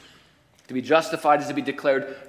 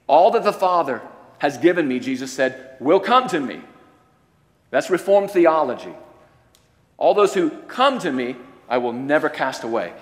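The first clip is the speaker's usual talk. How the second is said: full-force preaching; 2 semitones higher, 5 dB louder than usual. Acted. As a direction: proclaim it to the back row. Quoting Jesus loudly.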